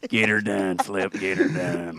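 Larry the Cable Guy. Acted. Comics quoting the headliner's catchphrase, lightly imitated. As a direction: toss out a comic's catchphrase, a hint of impression. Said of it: "Larry the Cable Guy in Atlanta".

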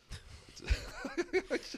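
high, loud laughter; real laugh. Real, not acted. Comic laughing at his own Arkansas joke, high loud laugh. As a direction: burst out laughing.

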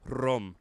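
"Rum" with a rolled R. Shown as a demonstration. Comic showing why rum is funny, "Rum" with trilled R. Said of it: "I got to roll the R's".

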